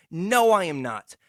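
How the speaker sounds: usual speaking voice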